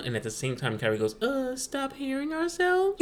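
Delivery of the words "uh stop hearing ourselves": put-on cheeky little boy. Son's cheeky answer.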